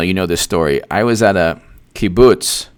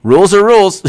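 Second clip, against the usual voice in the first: high, loud mocking cry; mock outburst; 11 semitones higher, 7 dB louder than usual. Commentator mocking the party's rules, high loud mock outburst. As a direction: exclaim it loudly, pitched way up.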